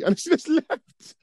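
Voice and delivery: laughing. Real, not acted. Laughing while retelling.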